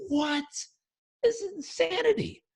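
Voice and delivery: high-pitched outraged disbelief; pissed off. Retold or imitated. Student to the master, high, indignant disbelief. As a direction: squeak out the outrage at being told no again. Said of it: "And the guy was pissed".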